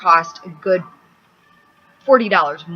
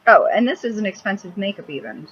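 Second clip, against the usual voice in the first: ordinary talk, nothing marked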